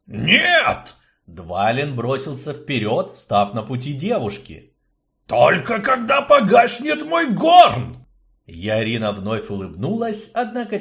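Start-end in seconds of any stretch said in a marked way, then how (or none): 0.1-0.9 high, loud refusal; shouted no. Acted. Dwarf blocks the way. 5.3-8.0 high, loud, slow dwarf vow; dwarf shouting. Acted. Dwarf vows, blocking her.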